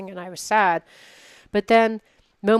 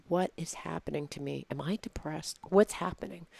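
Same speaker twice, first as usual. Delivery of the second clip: soft retold inner thought; hushed puzzled musing; bewildered self-questioning; 10 dB quieter than usual. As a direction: ask yourself quietly, puzzled.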